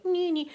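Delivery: high, soft violin imitation; playful "nee, nee" violin noise; mock tiny violin. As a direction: squeak out a tiny sad violin, quietly. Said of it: "Here's a wee tiny violin".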